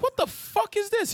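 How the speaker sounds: mock shocked exclamation; shocked outburst. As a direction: squeal out disbelief. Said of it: "I'm just like".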